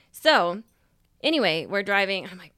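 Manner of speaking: ordinary talk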